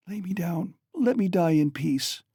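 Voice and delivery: high, weak and breathless; dying woman asking for peace; faint dying plea. A narrator's reading. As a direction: beg to be laid down, fading.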